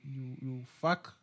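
soft slow grudging curse; quiet affectionate curse. Acted. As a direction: mutter it with admiration.

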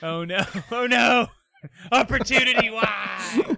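loud, high hammed-up wail; mock grief. Mourning the Mars rover as a joke, hammed-up loud wail. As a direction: cry out in fake despair for the rover.

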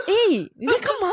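high startled question; retold shocked outburst. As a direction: blurt it out in shock. Retells shock at kneeling friend.

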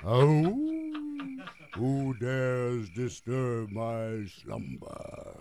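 slow, hammed-up ghost moan; ghostly apparition. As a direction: overact a spooky ghost. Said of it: "a ghostly apparition rises from the bushes".

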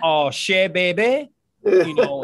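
loud, high Cajun voice; Cajun accent. Retold or imitated. Ex-player imitating Lafayette locals, loud Cajun accent. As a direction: holler it like a South Louisiana local. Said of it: "that, that accent".

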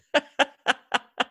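high, bursting laughter; amused laugh. Real, not acted. Host amused by his own favor, laughing.

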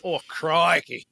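put-on Australian accent. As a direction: lay on a thick Aussie accent like a laid-back surfer. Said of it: "them beautiful Australian accents".

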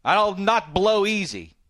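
loud, high, slowed protest; heated protest. Real, not acted. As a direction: shout your defence, dragging it out. Defending himself, heated and shouting.

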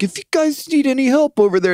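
high, mock eager offer; way too helpful, lifted up high; sing-song keen client voice; overeager helper. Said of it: "He's like".

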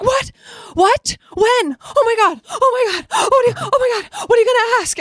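high, loud, squealing disbelief; excited mom shriek. Retold or imitated. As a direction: shriek like a starstruck fan. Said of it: "dropped her fork and was like".

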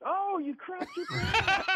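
high, slow, laughing mockery; mocking Tunch. Tunch teasing his teammate, high and laughing.

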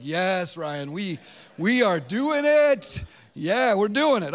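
eager congregation response. Acted. Preacher feeding his congregation their answer, hammed-up enthusiasm. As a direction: whip up an exaggerated group yes. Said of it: "Just humor me and say, like".

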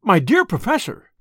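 alarmed assistant's outcry; crying out. A narrator's reading. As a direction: plead with him to stop. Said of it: "he cried".

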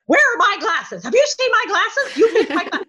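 high, loud, opinionated fussing, hammed up; Catherine McGregor. Actress imitating her co-star hunting her glasses, loudly opinionated. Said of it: "she was very opinionated".

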